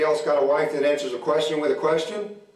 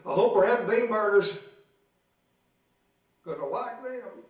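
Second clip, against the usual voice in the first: sarcastic meek; 7 semitones higher, 3 dB quieter than usual. Retold or imitated.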